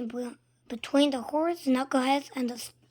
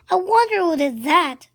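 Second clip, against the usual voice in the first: mock wondering; 4 semitones higher, 8 dB louder than usual. A narrator's reading. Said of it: "asked another fan".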